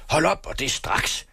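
high-pitched, acted angry command; screaming. A narrator's reading. Father ordering his son to stop, screaming. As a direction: order it sharply, pitch leaping up. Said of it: "skreg faren".

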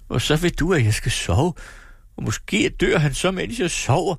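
horrified she could sleep now; alarmed mother. Acted. Mother to daughter, shocked and distraught, high.

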